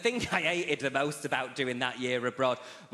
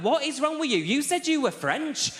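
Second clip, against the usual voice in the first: high, loud mock exasperation, slowing down; exasperated rant; 11 semitones higher, 3 dB louder than usual. Student snapping back at the professor, high and loud. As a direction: scream at him in disbelief. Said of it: "And I went".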